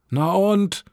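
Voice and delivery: high, defiant retort; growled retort. A narrator's reading. Teen snaps at mother about new neighbours, irritably. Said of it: "hab ich geknurrt".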